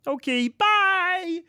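high, slow, cheery little frog; chirpy frog voice. Acted. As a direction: wave goodbye as a cartoon frog. Frog character leaves happily.

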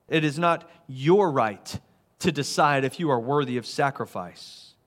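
ordinary delivery

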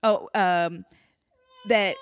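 ordinary talk, nothing marked

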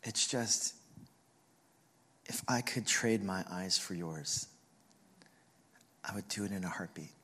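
low, soft, halting retelling; hushed, understated mimicry of a mom near tears; tearful mother. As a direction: speak tenderly with long pauses, holding back emotion. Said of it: "tearing up and beginning to weep".

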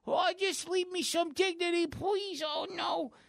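high, stammering neurotic whine; Woody Allen. Acted. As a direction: plead like a nervous, put-upon nebbish. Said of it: "he kinda looks like Woody Allen".

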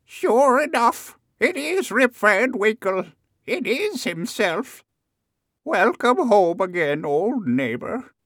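put-on very high, slow old woman's voice; exclaiming old woman. A narrator's reading. Old woman recognizes Rip and welcomes him, high exclamation. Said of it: "until an old woman".

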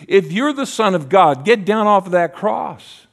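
taunting accusers. Acted. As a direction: jeer it upward. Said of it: "they would say".

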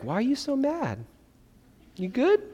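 high, soft sing-song checking in; mock sweet concern. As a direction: ask it in a sugary, clueless way. Mocking an unhelpful question.

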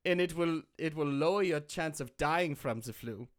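put-on German-accented doctor giving orders; measured mock-German reassurance. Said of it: "if I had a German accent".

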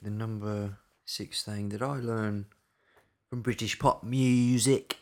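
slow, put-on British accent; hammed-up British announcing. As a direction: draw it out in a mock British accent. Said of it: "what different British accents can we do".

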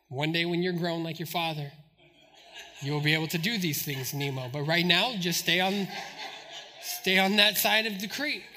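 low fatherly reassurance, soft at first; retold patronizing father. Father keeps his son back from the creek, low and steady. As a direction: talk a small boy down in a dad voice.

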